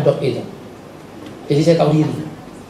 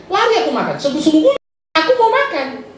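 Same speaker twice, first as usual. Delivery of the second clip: eager mock glee; 9 semitones higher than usual. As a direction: savor the escape to the food. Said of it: "ada acara kan ya".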